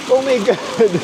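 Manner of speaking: high startled cry of shock; startled shriek. Real, not acted. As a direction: jump at the sudden cold splash. Sudden water pours on hand. (gulat na sigaw)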